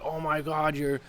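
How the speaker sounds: lightly voiced eager friend inviting him over; imitating the friend. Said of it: "she's like".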